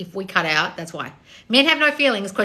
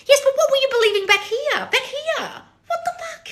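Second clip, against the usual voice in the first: very high exasperated outburst; incredulous, high-pitched rant; losing it. Real, not acted. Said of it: "I'm losing my shit".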